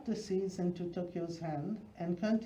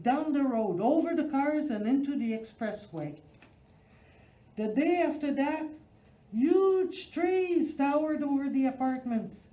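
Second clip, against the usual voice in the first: building excitement; 7 semitones higher, 5 dB louder than usual. A narrator's reading. Story builds to wild climax.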